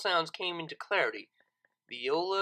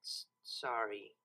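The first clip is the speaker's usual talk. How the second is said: softer, lower, slow stammered sorry; tiny apology; 7 semitones lower, 11 dB quieter, slower than usual. A narrator's reading. Lyra finally apologizing, small and quiet. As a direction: barely get the word out. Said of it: "a very small, yet oh so momentous word".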